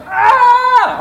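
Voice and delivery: high loud shriek; scared scream. Shown as a demonstration. Showing everyone how to react, a scared scream. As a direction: shriek in fright. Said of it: "Everyone's gonna go".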